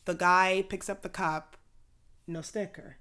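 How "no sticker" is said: low, much softer deadpan statement; hushed reveal. Real, not acted. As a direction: drop your volume for the bad news. Hushed reveal of the empty cup.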